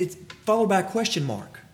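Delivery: ordinary delivery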